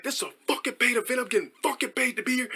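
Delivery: high, held-back imitated protest; mock arguing. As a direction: protest in a squeezed, put-on angry voice. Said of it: "we were just going back and forth".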